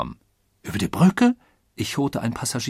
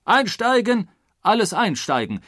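high, loud conductor's cry; shouted boarding call; 10 semitones higher, 5 dB louder than usual. A narrator's reading. Conductor calling passengers aboard, shouting. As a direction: call it out down the train. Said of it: "schrie der Zugführer".

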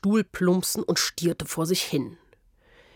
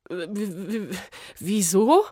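stuttering. A narrator's reading. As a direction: stammer it out, flustered. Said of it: "stotterte Smyrna".

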